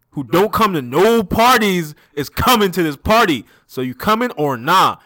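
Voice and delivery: acted friend hyping up a party; loud hype. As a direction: press the invite emphatically at full volume. Acting [name] pushing the party invitation.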